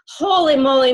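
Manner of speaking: loud, thrilled holy moly; excited exclamation. Real, not acted. Host closing the Zoom, loud and delighted. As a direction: let the excitement burst out.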